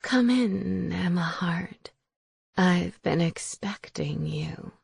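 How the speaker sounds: put-on slow, whispered old crone; whispering witch. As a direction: whisper it like a sinister hag. Witch Raisa greets Emma.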